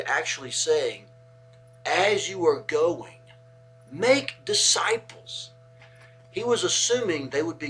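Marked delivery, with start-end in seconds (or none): 1.9-5.5 slow, loud, raised quoted command; emphatic quote. Retold or imitated. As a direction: draw out "make disciples", high and forceful, each word weighted. Quoting Jesus, stressing the real command.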